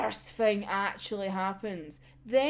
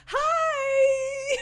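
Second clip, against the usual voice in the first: high excited hi; 13 semitones higher than usual. Retold or imitated. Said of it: "And it's like".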